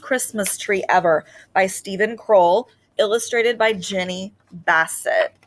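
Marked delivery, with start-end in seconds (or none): none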